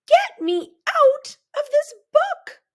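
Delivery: high, loud, panicked scream; frantic high shriek to be let out. A narrator's reading. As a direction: scream it like you're trapped in a nightmare. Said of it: "and I screamed".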